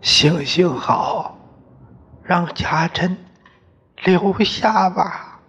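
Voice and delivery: high, slow, tearful begging; mother pleading for her daughter-in-law to stay; tearful plea. Acted. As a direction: beg him with tears in your voice. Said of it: "眼泪汪汪地".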